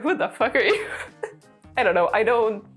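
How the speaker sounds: normal talking voice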